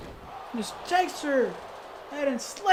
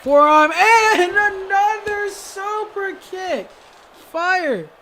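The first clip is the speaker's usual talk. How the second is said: high, loud, long stretched call; big wrestling commentator cry over a hit; excited shout; 9 semitones higher, 10 dB louder, much slower than usual. Real, not acted. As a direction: holler it out, drawing out the words. Calls another super kick.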